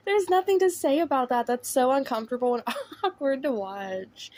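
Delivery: high, giggly reaction breaking into laughs; laughing discomfort. Real, not acted. Vlogger reacting to an awkward clip, laughing uncomfortably. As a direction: talk through laughter, pitched high.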